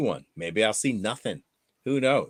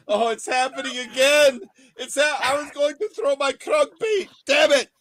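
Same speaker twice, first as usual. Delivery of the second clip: frustrated angler; 13 semitones higher, 3 dB louder than usual. Pretend.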